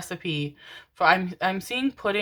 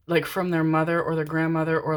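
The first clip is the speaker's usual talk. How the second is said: usual speaking voice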